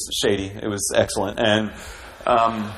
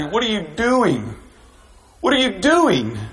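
higher, disbelieving what are you doing; inner exasperation; 8 semitones higher than usual. Retold or imitated. Pastor recalling his silent outrage at the minister, disbelieving. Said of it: "I'm just thinking".

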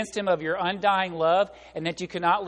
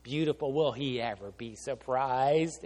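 scheming glee; 5 semitones lower, 4 dB quieter, slower than usual. Retold or imitated. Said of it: "And she said".

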